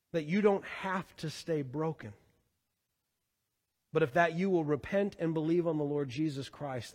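ordinary talk

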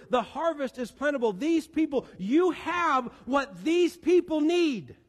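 high, emphatic preaching plea; impassioned urging. Acted. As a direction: press each phrase on the disciples, pitched high. Preacher acts out Jesus urging disciples.

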